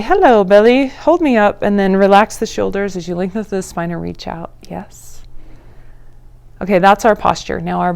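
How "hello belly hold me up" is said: bright, louder playful hello to the belly; chirpy call to the belly; cheerful greeting to belly. Shown as a demonstration. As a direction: greet your belly like a friend, a bit higher.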